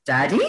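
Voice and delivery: co-host reminding her how to say it, questioning "Daddy"; pronunciation check. Shown as a demonstration. Co-host correcting her friend on how you say daddy, questioning. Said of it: "Remember how you say daddy?".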